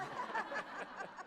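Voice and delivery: quick breathy giggle up high; short high laugh. Real, not acted. Comic laughing at her own Aussie joke, high giggle.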